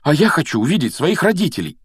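high, heated insistence; worked-up retort; insistent protest. Acted. Boys squabbling over the mirror.

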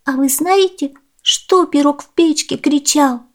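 put-on high, girlish voice; high-pitched earnest child; little girl insisting. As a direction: tell the grown-up as an upset child.

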